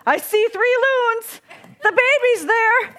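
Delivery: slow, mildly relieved announcement; relieved report. Retold or imitated. Brother Matt reporting through binoculars, mildly relieved. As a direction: let the relief show gently. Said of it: "reported with noted relief".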